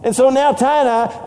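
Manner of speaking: ordinary talk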